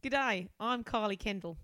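hammed-up Australian greeting; horrendous Australian. Carly introducing herself, in a horrendous Australian accent. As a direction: put on a bad Aussie accent. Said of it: "Horrendous Australian".

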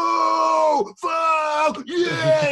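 high, shouted rush of a first hit; mock-ecstatic yelp of a man on crack; euphoric whoop. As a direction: whoop it out like the high just landed. Junkie high in crime book.

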